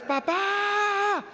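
high call of papá from the stairs; child calling dad. Retold or imitated. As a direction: call papá like a little kid upstairs. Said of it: "escucho en las escaleras". (niño que llama a su papá desde la escalera)

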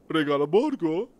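strange questioning penguin voice; odd penguin voice. Acted. Penguin king repeats the elf's words, odd puzzled voice.

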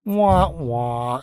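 drawn-out mock "wah-wah"; comic deflating horn noise; sad trombone imitation. Imitates sad-trombone ending sting.

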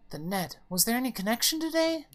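high, rising mother's voice; sudden worried question. Acted. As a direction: hurry into a high, alarmed question. Mother suddenly worries about connection.

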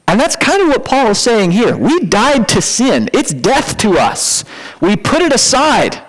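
usual speaking voice

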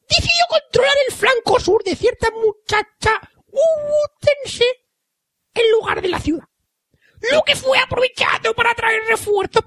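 put-on high, shouting old grandma voice. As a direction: crow it out like a shrill old granny. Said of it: "con la voz de abuela".

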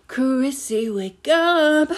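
loud, high, drawn-out call for Chrissy; dramatic wake-up call. Pretend. Podcaster mimicking a Stranger Things scene, calling out loud. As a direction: cry out for Chrissy like a horror victim.

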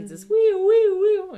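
high, loud, drawn-out wailing siren; siren imitation. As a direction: wail it like a fire alarm. Alarmsirene wird nachgemacht. (hohe, laute Sirene nachgeahmt)

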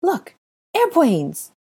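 put-on high, excited little-boy cry; high squeal of discovery; excited child. A narrator's reading. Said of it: "pointed excitedly".

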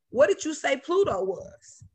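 ordinary delivery

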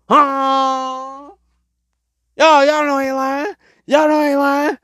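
high, strained comic protest; hammed-up outrage. Comedian telling fellas about biscuit blame, high hammed-up outrage. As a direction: wail it to the fellas in mock disbelief.

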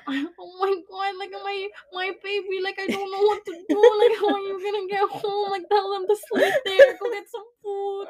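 high, teary mock of a frantic mother; panicked mom. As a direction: imitate mom fussing in near tears. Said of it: "She was like".

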